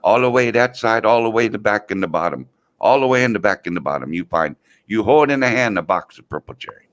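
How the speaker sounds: lightly voiced old Chinese guy giving directions; old Chinese man imitation. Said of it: "He says".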